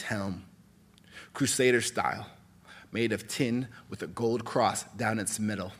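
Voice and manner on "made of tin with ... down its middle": usual speaking voice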